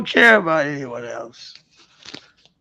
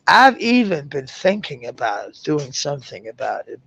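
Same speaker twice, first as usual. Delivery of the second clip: ordinary delivery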